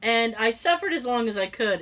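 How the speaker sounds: usual speaking voice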